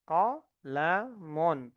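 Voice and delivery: qalamun very slow, short qa kept; slow model word. Shown as a demonstration. Teacher repeats qalamun slowly for the class. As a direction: lay out qalamun slowly, syllable by syllable.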